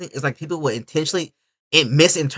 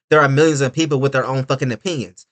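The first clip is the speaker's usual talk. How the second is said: usual speaking voice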